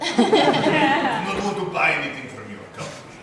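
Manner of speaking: mad Russian. Retold or imitated. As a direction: ham up a cranky Russian accent. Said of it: "Sometimes you wanna mad Russian".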